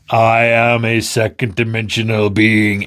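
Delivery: otherworldly announcer to a tiny creature; mysterious being. Acted. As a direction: intone it like a strange apparition. [name] poses as mysterious being.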